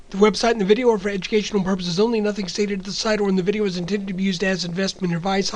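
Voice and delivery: rapid-fire disclaimer, no breath; in one breath. Real, not acted. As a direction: race through it without breathing. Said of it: "I'm gonna try to do it in one breath".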